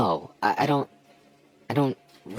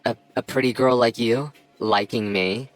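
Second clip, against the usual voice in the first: normal talking voice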